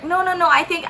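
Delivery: high-pitched imitation of an aunt; placating. Aunt excusing her embarrassed niece to stranger, placating.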